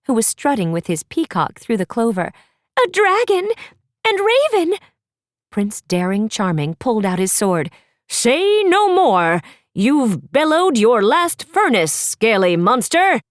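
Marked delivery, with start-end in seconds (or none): 2.8-4.8 high, loud call across the meadow; yelled call. Acted. Apple calling the prince from afar, loud yell. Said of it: "Apple yelled to the prince". 8.1-13.2 slow, loud, hammed-up heroic declaration; heroic prince. Daring drawing his sword at the dragon, pompous heroic boast.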